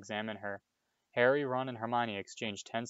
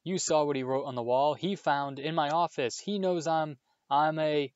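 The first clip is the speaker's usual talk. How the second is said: higher and louder, breaking off; Filch ranting; 6 semitones higher, 5 dB louder than usual. A narrator's reading. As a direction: rage choking the words.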